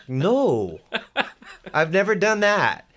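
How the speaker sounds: high, laughing denial; laughing disbelief. Retold or imitated. Young player answering Joe about flying, laughing high. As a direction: laugh it off, caught off guard. Retold own startled reply.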